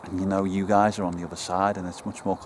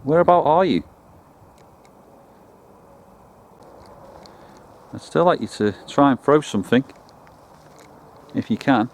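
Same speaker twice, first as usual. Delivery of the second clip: loud, higher-pitched call to an unseen presence; 4 semitones higher, 7 dB louder than usual. Real, not acted. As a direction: project it, inviting them to answer. Calling out to unseen spirits.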